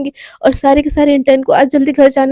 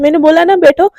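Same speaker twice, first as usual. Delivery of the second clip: plain reading voice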